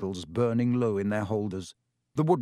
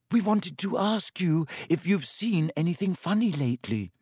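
higher, hasty interjection; quick; 6 semitones higher than usual. A narrator's reading. Hermione heads off Myrtle's lament, quick. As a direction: jump in before she wails on. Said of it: "said Hermione quickly".

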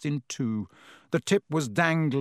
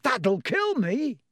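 dismayed cry; 9 semitones higher, slower than usual. A narrator's reading. Car leaves, wand broken.

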